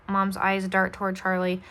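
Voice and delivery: usual speaking voice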